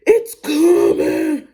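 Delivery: high, loud and dragged out slowly; imitating a woman in labor, loud and high; labor cry. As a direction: strain it out like mid-contraction. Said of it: "She's like".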